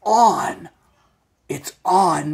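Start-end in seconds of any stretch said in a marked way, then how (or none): 0.0-0.7 "on" said louder and higher; emphatic "on". Shown as a demonstration. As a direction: land on "on". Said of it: "You can also say the silly hat is".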